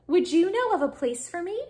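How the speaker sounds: high, hopeful small-crab question; little hermit crab. A narrator's reading. Smaller crab asks Hermit Crab for a home, high voice. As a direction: ask it up high as the little one.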